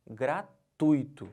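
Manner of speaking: "Gratuito" slowly as gra-TUI-to, UI one syllable; stress on TUI. Shown as a demonstration. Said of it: "eu estou marcando a sílaba". ("Gratuito" devagar, TUI numa sílaba só)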